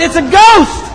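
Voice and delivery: high, loud cry of alarm; frightened cry. Acted. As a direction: cry out in acted terror. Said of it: "they cried out in fear".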